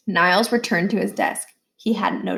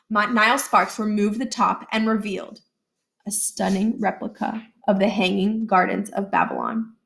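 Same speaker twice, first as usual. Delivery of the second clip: ordinary delivery, nothing marked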